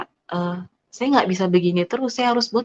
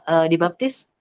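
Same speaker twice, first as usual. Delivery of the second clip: normal talking voice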